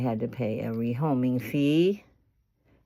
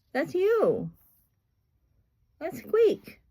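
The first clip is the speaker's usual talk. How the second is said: coaxing pet talk; slower than usual. Real, not acted. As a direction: coax a bird to answer, squeaky. Talking to the parrot.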